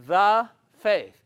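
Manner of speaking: the faith, with THE hit high and loud; stress on the faith. Shown as a demonstration. Preacher stressing the faith to his congregation. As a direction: lean hard on the word the. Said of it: "the" is a definite article".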